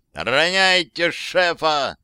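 slow, stretched yell of alarm. Acted. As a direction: bellow the order slowly. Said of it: "заорал я".

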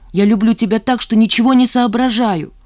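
fervent, overwhelmed outburst; impassioned confession. A narrator's reading. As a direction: pour it out with rising passion. Desperate confession of love.

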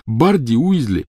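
talking through a bloody mouth; slurring past blood; mouth full of blood. Acted. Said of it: "полон рот крови".

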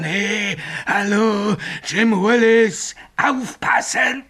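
high, drawn-out eerie call; ghostly call. Retold or imitated. Signalman shows Bill the ghost's call, eerie and high. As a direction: wail the name like the phantom.

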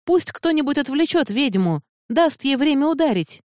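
high, strained call over battle noise; urgent shouted order. A narrator's reading. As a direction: call to the crew, pitch held high throughout. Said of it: "перекрывая грохот, крикнула Сабран".

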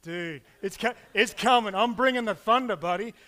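a fan warning the cheating ref, loud taunt; taunting yell. Retold or imitated. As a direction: jeer at the ref from the stands. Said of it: "you look at the ref and you go".

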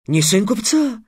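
high-pitched puzzled echo; surprised question. A narrator's reading. Slave trader questioning Trix's claim, surprised. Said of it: "удивился работорговец".

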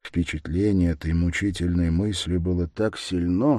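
ordinary delivery, nothing marked

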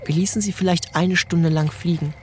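normal talking voice

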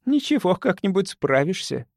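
sly high teasing. Acted. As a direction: tease him slyly. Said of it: "Она хитро усмехнулась".

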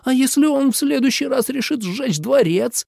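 high, alarmed protest; breathless indignation. A narrator's reading. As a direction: demand it aghast, pitch way up. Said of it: "задохнулся Минлу". (возмущённо, на высоких нотах)

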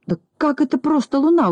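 high, incredulous question; acted amazement, voice pitched high; astonished. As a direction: question it in disbelief, pitch jumping up. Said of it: "удивился Пончик".

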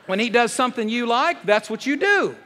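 high, loud, punchy preacher delivery; loud preaching. Acted. Pastor joking to wives, loud preaching. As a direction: play it big for the laughing crowd.

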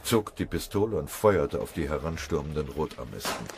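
plain reading voice

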